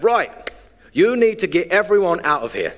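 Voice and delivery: high, forceful British soccer coach; British accent. Retold or imitated. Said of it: "He's British".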